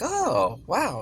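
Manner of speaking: a bright little gasp of discovery; surprised. Retold or imitated. Recalling finding her old home in the book, surprised.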